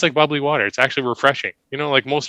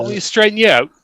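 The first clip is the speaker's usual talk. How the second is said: mild English hooligan, a bit higher; squaring up, London lad style; English hooligan threat; 5 semitones higher than usual. Pretend. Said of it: "soccer hooligan".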